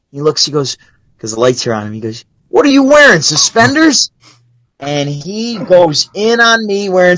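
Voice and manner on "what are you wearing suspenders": high-pitched imitation of a mocking comic; mock incredulous ribbing. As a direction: pick on an audience member, pitched up. Comic ribbing a man in audience.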